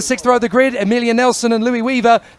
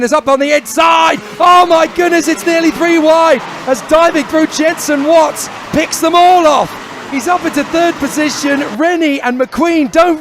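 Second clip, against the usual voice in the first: higher and louder, rising thrill; excited commentary; 5 semitones higher, 3 dB louder than usual. Real, not acted. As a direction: shout the overtake as it happens. Three-wide overtake live.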